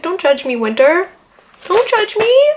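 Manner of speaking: rising whiny don't judge me; pleading whine. Retold or imitated. Begging Winter not to judge her, high whiny plea. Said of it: "I'm like".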